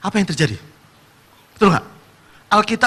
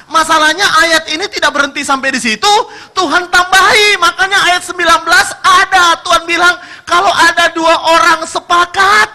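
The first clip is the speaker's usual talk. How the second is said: loud, high preacher's cry; preaching at full force; 10 semitones higher, 7 dB louder than usual. Acted. Preacher proclaiming verse nineteen to the church, loud and high. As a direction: proclaim it to the congregation.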